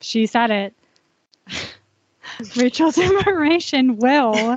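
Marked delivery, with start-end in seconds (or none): 1.5-4.6 laughing. Real, not acted. Host laughs after guest's open-book remark.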